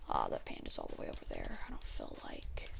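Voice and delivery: soft unvoiced whisper. Real, not acted. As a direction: whisper it under your breath. Quietly whispering while playing.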